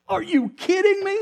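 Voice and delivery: high, loud burst of amazement; excited disbelief. Real, not acted. Preacher marvels at the story to his congregation, loud and high. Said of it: "mind-challenging, exciting".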